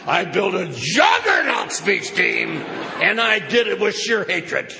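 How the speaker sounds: loud and high, proud fury; half-comic shout of hatred. Real, not acted. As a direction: roar it out with pride. Building a juggernaut team, shouted.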